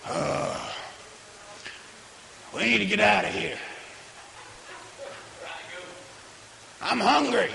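quiet, fast, mumbling impatience; mock the restless pew-sitter; bored churchgoer. A churchgoer in the pew ignoring someone's salvation, quiet and impatient mumble.

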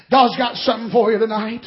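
ordinary talk

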